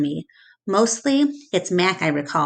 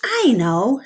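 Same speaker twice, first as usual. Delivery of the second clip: exclaiming; 6 semitones higher, 3 dB louder than usual. A narrator's reading. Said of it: "she exclaims".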